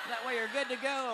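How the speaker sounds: usual speaking voice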